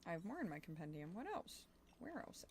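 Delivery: ordinary talk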